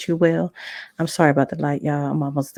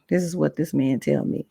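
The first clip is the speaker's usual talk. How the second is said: normal talking voice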